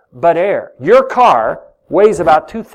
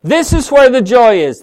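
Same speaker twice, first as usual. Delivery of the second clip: distant, calling from next room; from another room; 3 semitones higher, 4 dB louder than usual. Real, not acted. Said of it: "I'm in the other room".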